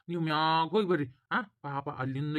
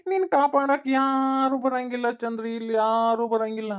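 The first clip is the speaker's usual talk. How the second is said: high, slow, taunting sing-song; louder, slow, drawn-out taunt; gloating villain; 6 semitones higher, 3 dB louder, slower than usual. Acted. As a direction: stretch each word to mock her. Villain gloats over trapped girl.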